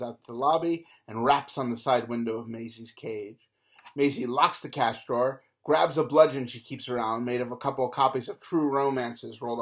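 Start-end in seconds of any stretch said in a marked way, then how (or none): none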